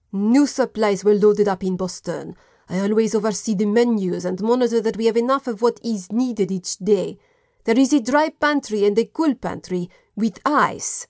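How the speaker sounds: put-on a light French accent, proud of his pantries; lightly voiced French chef explaining; French cook's accent. French cook showing the inspector his stores, mild French accent.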